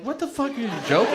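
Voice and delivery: high, rapid incredulous question; imitated outraged disbelief. Staff reacting to the joke note, imitated outraged disbelief. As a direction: fire it off fast and high.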